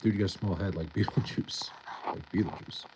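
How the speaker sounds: whispered through a laugh; breathy giggling whisper; amused whisper. Real, not acted. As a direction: hush it, half laughing. Reading a chat joke, amused.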